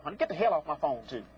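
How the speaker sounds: ordinary talk, nothing marked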